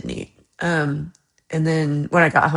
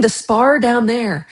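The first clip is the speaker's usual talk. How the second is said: slow, imitating a local saying Spar; drawn-out local accent, pitched up; mock British woman giving directions; 5 semitones higher, 4 dB louder, slower than usual. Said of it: "she's saying".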